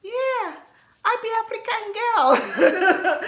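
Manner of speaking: high, slow, playful pidgin voice with a laugh; mock naive self. Younger self answering the kids, high and playful. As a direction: play your younger self hamming it up. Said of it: "and I'm like".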